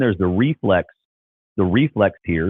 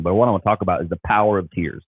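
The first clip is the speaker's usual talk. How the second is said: usual speaking voice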